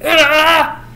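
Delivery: a short, high, loud angry yell; hammed-up frustrated shout. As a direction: blurt it out in mock fury. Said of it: "Flick gets mad".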